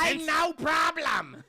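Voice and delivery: slow, high, loud comic shout; loud slow comic bark; mock shout. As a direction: bellow it like a cartoon.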